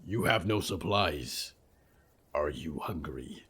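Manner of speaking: low, rumbling monster voice; low creature voice. Acted. Creature speaks.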